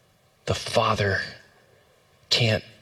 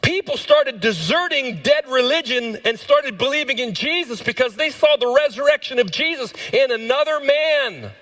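full-force preaching; 13 semitones higher than usual. Real, not acted. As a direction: preach it at the top of your range, each phrase held high.

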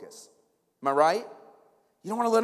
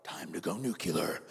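very low, softer declaration; scheming kid; 9 dB quieter than usual. Acted. Kid deciding to escalate the tantrum, ominous. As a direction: announce it like an ominous battle plan. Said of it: "They're like".

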